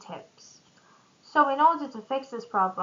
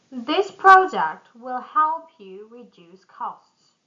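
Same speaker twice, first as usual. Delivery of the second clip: louder, punchy pitch line; energetic sales pitch; 9 dB louder than usual. Shown as a demonstration. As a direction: sell it with energy. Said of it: "the next examples are sales pitch".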